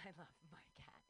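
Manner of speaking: breathy whisper of love; soft secret whisper. Real, not acted. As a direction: whisper it tenderly to yourself. Whispered aside about cat.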